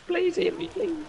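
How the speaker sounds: high, loud begging; pleading. Real, not acted. Begging teammate for healing.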